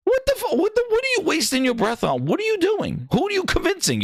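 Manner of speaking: high, exasperated questions; exasperated at the fans who defend it; incredulous rant. Real, not acted. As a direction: pitch it up in disbelief.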